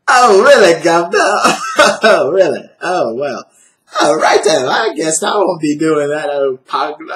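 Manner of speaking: loud, high-pitched hammed-up Cockney; mock Cockney accent. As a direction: boom out a cartoonish Cockney "Governor" routine. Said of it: "Cockney posh accent you guys do".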